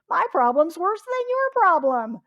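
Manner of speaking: put-on loud, high-pitched childish-style boast of misery; mock one-upping complaint. Complainer outdoing another's troubles, mock one-upping. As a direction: top the other gripe, loudly exaggerated. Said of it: "Someone else starts complaining".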